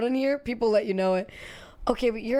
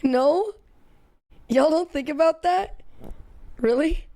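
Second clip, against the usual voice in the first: loud, high disbelieving questions; can't believe nobody thinks so; incredulous; 5 semitones higher, 5 dB louder than usual. Real, not acted. Disbelief at chat's answer.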